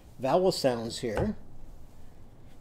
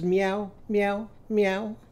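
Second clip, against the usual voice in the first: kitten meow; 5 semitones higher, slower than usual. Shown as a demonstration. Teacher voices kitten.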